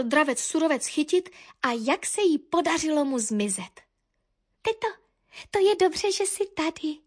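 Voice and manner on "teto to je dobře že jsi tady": lightly voiced happy little bird; cheerful chirping. A narrator's reading. Little niece greets her aunt, cheerful chirping. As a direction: chirp it, pitched up. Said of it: "cvrlikala Korinka a vesele poskakovala".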